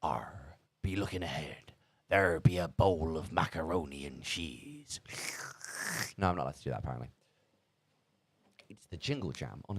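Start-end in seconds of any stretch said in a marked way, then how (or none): 0.0-5.0 put-on soft pirate accent. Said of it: "do me pirate accent".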